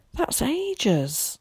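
mild, high-pitched moan of complaint. A narrator's reading. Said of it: "he moaned".

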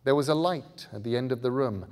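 usual speaking voice

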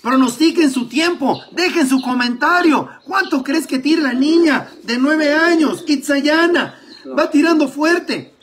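loud, high sports commentary; live play-by-play. Acted. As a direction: call the race live and excited.

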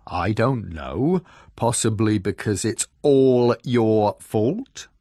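slow, drawn-out mock pondering; sarcastic bear. A narrator's reading. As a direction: pretend to wonder, then pin the blame. Bear sarcastically blames fox.

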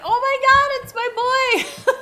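high, loud reenacted squeal; high, laughing replay of amazed joy; retold excitement. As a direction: relive the thrilled shout, breaking into laughter. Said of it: "and I was like".

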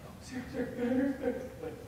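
high, longing murmur of her name; dreamy mock swoon; lovestruck sighing. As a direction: moon over her name like a smitten kid. Pining for his crush.

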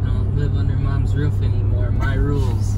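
loud defiant declaration. Real, not acted. Mock defiant proclamation.

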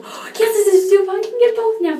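high, loud, thrilled rush; gasp, then burst with joy; excited gush. Retold or imitated. Thrilled to find both lip balms in stock, high and loud. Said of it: "I was like, gasps".

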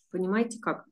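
ordinary delivery

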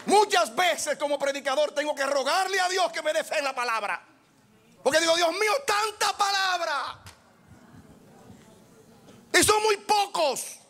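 a fired-up preacher pleading with the church; fiery preaching. Real, not acted. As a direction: raise the voice and drive each question home. Preaching at full force.

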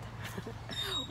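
brief laugh at a slip; short amused laugh mid-sentence. Real, not acted. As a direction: laugh off a stumble. Stumbles over her own sentence.

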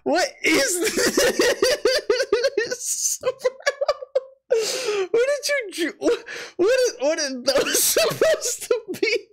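high, slow questions broken up by real laughter; can barely ask for laughing; laughing helplessly. Real, not acted. Cracking up over a drawing.

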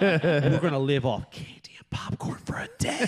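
excited rasp breaking into a whisper; overjoyed dad, voice giving out; hammed-up giddy promise. Hyped dad promising movie binge.

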